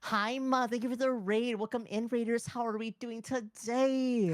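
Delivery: high, bright streamer greeting; cute anime streamer. Acted. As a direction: welcome the raiders, sweet and high.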